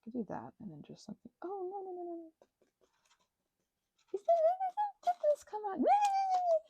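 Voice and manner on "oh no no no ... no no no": surprised dismay. Real, not acted. Reacting to her drying craft.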